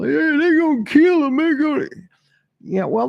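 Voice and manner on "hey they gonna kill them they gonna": high, loud mock of an excited fan; loud, high-pitched mocking imitation; hyped-up commenter. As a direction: mimic a worked-up commenter, pitched way up.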